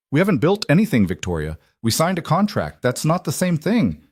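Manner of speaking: exasperated, mildly angry retort; heated argument. A narrator's reading. Heated argument between the couple.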